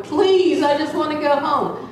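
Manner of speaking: high-pitched begging; retold pleading.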